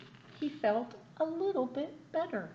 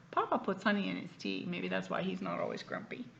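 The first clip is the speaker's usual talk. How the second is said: ordinary delivery, nothing marked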